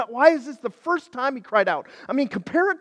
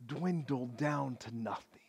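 usual speaking voice

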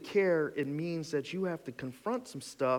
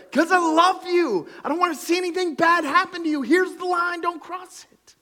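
much higher, louder pleading cry; loving God, not calm; 10 dB louder than usual. Retold or imitated. Preacher voicing God begging his people not to cross. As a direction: plead hard out of love. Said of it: "I wasn't so calm right there".

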